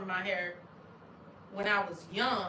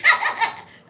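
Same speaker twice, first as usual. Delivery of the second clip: shrieking burst of laughter; high loud laugh; 11 dB louder than usual. Real, not acted. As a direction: let out a high whoop of a laugh. Laughing at own joke.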